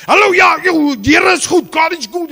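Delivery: very loud, high booming praise; man booming praise in a bookshop; loud greeting. Retold or imitated. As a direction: shout it out with joy. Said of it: "and he's loud".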